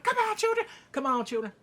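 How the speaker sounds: loud, high cheering yell; egging on. Acted. Viewer cheering on brawling children, excited yell. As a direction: yell it like a rowdy spectator. Acting own reaction cheering on the fight.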